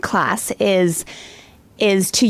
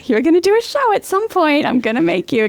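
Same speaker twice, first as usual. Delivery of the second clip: cheering him on; 9 semitones higher than usual. Retold or imitated. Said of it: "all the time, I'm like".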